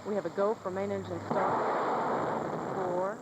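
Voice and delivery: steady launch countdown call; counting down to go. Real, not acted. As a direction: count down to liftoff, measured and firm.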